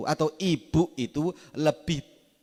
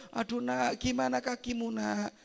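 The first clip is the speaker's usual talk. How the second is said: higher, softer fretting mother; worried mother; 8 semitones higher, 6 dB quieter than usual. Retold or imitated. Mother fussing over a child who fell off a bike, high and soft. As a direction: fuss over the child's hurt leg. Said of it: "ibu elo".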